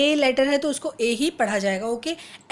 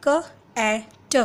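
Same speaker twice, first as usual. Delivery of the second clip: slow, letter by letter; sounding out letters; much slower than usual. Shown as a demonstration. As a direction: sound out each letter slowly. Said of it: "ए पढ़ेंगे".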